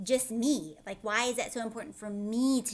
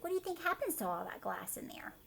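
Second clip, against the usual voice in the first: whisper; 6 semitones higher, 5 dB quieter, faster than usual. Acted. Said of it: "It's like".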